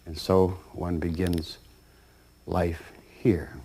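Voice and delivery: soft, low whisper; hushed. Real, not acted. Narrator closing the birth story, hushed whisper. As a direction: let it drop to a whisper. Solemn close of birth scene.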